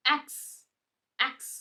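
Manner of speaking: ordinary delivery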